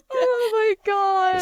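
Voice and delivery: high, drawn-out laughing exclamation; laughing hard. Real, not acted. Host reacting to fluffed catchphrase, laughing hard. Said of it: "Why are they laughing so badly?".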